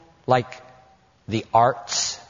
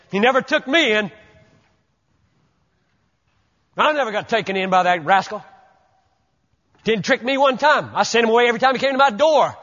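higher, fast, proud listing of his own caution; preacher mocking a believer who boasts he was never fooled, fast and proud; smug self-righteous boast; 6 semitones higher, faster than usual. To the congregation, mocking the believer proud he was never fooled, fast smug boast. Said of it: "the last thing you will want to say at that moment is".

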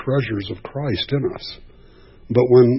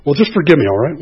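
a dismissive, breezy plea that is louder; offhand demand; 4 semitones higher, 6 dB louder, faster than usual. Retold or imitated. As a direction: toss off the apology like it's nothing. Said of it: "The way you say that".

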